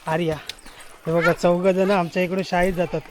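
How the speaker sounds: ordinary talk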